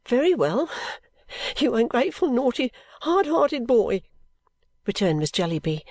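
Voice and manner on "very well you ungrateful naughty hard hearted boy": tearful scolding. A narrator's reading. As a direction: scold through tears, dragging naughty, hard-hearted. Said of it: "with tears in her eyes".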